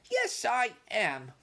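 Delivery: firm, slow, high insistence; stubborn young mouse standing firm; stoutly. Acted. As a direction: plant your feet and insist. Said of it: "said Ralph stoutly".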